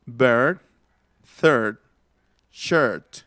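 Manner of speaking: separate, careful bird, third, shirt with r-coloured vowel; English vowel demo. Shown as a demonstration. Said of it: "pero más cerrada".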